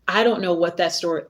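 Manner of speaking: usual speaking voice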